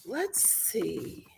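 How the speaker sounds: soft whispered aside; whispered muttering. Real, not acted. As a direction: murmur it to yourself. Whispering while looking.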